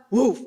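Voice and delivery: brief, loud imitated woof; dog bark. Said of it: "The dog barks".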